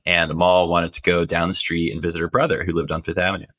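ordinary talk

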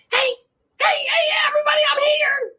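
very high, squeaky shouted greeting; squeaky little executive. Retold or imitated. Tiny TV executive arriving in the woods, squeaky shout. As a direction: announce yourself in a tiny squeaky voice. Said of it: "here come little squeaky dude".

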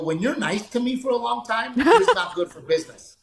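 rising squeaky mock protest; mock complaint to wife. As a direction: pitch climbs sharply into a comic whine. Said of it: "I tell my wife, I go".

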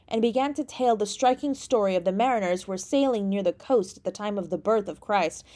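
usual speaking voice

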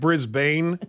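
Brisbane as BRIZ-BAYN; spelling pronunciation. Shown as a demonstration. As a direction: say "Brisbane" as it looks, ending like "bane". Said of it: "it looks like".

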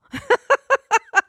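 very high burst of laughter; laughing. Real, not acted. Realising you can recall after all, high real laughter. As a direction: crack up at yourself, high and quick. Laughs on catching own contradiction.

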